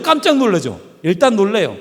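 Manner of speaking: ordinary delivery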